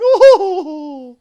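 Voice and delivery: high, loud hooting laugh; triumphant villainous laugh; villain cackle. Acted. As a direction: cackle loudly to finish. Smithy gloating to the general.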